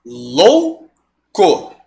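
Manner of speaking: Louco as "lou... co", pause before co; two slow beats for Louco; split into syllables. Shown as a demonstration. Teacher separating syllables, Louco as lou... co. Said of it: "Como é que eu separo louco?".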